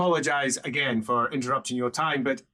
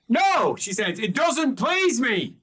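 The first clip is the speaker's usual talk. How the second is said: haughty refusal; 9 semitones higher, 3 dB louder, slower than usual. Acted. Etelka rebuffs the Emperor.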